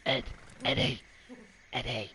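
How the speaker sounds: a hint of a spooky old Englishman calling a name; lightly voiced ghost calling out, mischievous; mild mock poltergeist call; creepy poltergeist voice. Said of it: "But where he goes".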